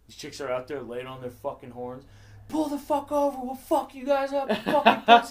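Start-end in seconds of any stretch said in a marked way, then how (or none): 2.5-5.3 high, loud road-rage scream; screaming, retold. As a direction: imitate furious screaming from a car. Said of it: "Fucking screaming at him".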